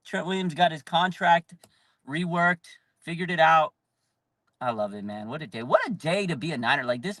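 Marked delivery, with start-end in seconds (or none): none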